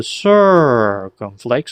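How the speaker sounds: first syllable of "circumflex" stretched, loud, rising then falling; long pitch hump opening "circumflex". Shown as a demonstration. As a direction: draw out the start of "circumflex" in a swooping arch. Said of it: "would have you kinda do a".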